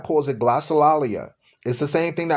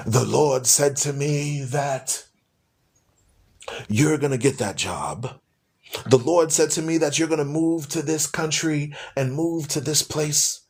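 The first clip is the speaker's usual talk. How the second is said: mock prophet voice; 3 semitones lower than usual. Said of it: "they come and say you".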